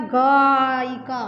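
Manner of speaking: गायिका with a very long "gaaa" before "i-kaa"; stretched first syllable. Shown as a demonstration. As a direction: draw out the opening aa of गायिका to show the sound. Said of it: "आय आ रहा है ना".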